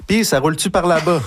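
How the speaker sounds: question des gens imitée. Retold or imitated. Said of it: "les gens demandaient". (question rapportée, voix plus aiguë)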